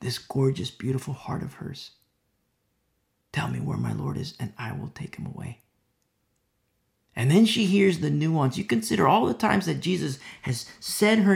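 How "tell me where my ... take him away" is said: much lower, soft plea; Mary's quiet broken plea; hushed plea. Acted. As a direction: murmur it brokenly.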